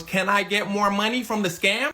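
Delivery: full-voiced, clear repeat. Partner repeats the request to Hush Puppy, full-voiced. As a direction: say it openly, no hushing.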